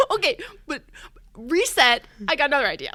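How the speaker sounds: loud, laughing pitch to investors; laughing mock pitch. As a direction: fake confidence, cracking up. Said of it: "And I'd be like".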